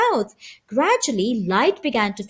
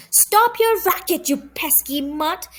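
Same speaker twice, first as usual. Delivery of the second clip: higher, sharp scolding of the dog; irritated owner; 5 semitones higher than usual. A narrator's reading. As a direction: snap at a noisy pet.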